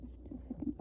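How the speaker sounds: unvoiced whispered aside; quick whispered "just a second". Real, not acted. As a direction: whisper it off to the side. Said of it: "whispered aside".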